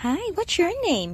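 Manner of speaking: high sing-song question; high-pitched friendly cooing; pet talk. Real, not acted. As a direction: coo a greeting at a cat. Talking to the cat.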